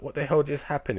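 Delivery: pitched high in mock shock; a stunned, high-voiced what the hell; imitating a stunned fighter, voice jumping up; shocked disbelief. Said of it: "he's completely shocked".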